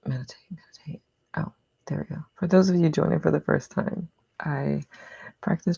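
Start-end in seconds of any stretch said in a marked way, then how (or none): 0.1-1.0 whispered aside. Real, not acted. Quiet aside to herself.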